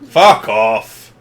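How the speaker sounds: loud, blunt pub heckle; angry heckler. Acted. Punter refusing to apologise to performer, angry heckler. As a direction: throw it loudly at the stage.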